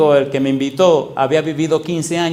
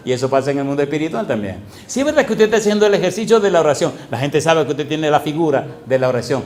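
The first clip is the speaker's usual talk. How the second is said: usual speaking voice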